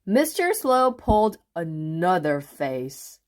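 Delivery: ordinary delivery, nothing marked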